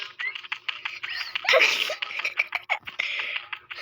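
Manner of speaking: high-pitched fit of laughter; laughing. Real, not acted. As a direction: laugh hard and high, unable to stop. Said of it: "Stop laughing. It's not funny".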